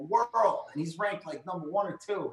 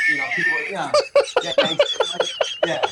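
bursts out high and loud laughing; laughing shout; 9 dB louder, faster than usual. Real, not acted. Recalling absurd soap plots, loud laughing. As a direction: laugh it out over the words.

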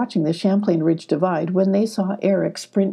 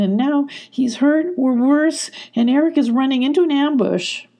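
higher, distraught, a touch of sob; tearful; 6 semitones higher than usual. A narrator's reading. Sylvie blaming herself to the foxes, mildly tearful. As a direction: blame yourself in tears. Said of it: "started to sob".

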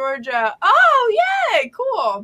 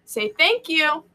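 prompting; 4 semitones higher, slower than usual. Retold or imitated. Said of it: "and I say".

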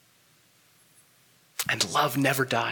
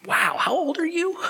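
high, breathy whispered gasp of disbelief; an amused aside, as if stunned by a child's question; mock amazement. Father reacting to his young daughter's question, high whispered amazement.

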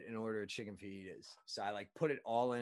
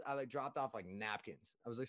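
ordinary talk

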